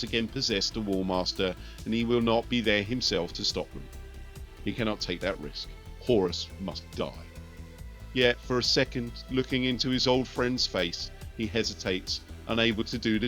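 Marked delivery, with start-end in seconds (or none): none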